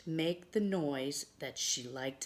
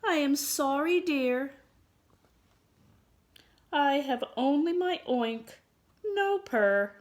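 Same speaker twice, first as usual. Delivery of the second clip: high, louder storybook pig; high-pitched pig answering kindly; apologetic pig; 11 semitones higher, 6 dB louder than usual. Acted.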